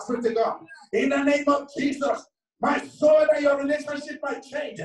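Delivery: loud, high, urgent prayer call; rousing church exhortation at a high pitch; fervent preaching. Real, not acted. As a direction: preach it at full force, voice lifted high. Preaches at full force.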